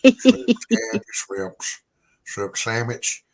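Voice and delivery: Southern Bubba drawl, high and loud, rattling off the shrimp list; hammed-up Bubba accent going through the shrimp dishes; Bubba Gump impression. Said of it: "say it like Bubba from Forrest Gump".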